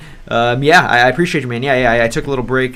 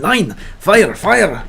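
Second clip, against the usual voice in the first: punchy, raised mock-German bark; aggressive mock-German accent; 5 semitones higher, 3 dB louder, much slower than usual. Shown as a demonstration. Streamer testing chat's tip, aggressive fake German accent. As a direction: attack each word in a put-on German accent. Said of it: "put on a Russian accent and speak as aggressively as possible".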